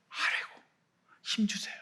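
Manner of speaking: breathy whisper asking for strength; barely voiced request; whispered plea. Believer whispering a plea for strength to God.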